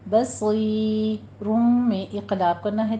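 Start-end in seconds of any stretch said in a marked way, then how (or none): 0.1-1.6 بصیرم slowly with meem for the tanween; iqlab. Shown as a demonstration. Teacher modelling iqlab in بصیرم. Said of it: "تنوین کا قاعدہ ٹو count".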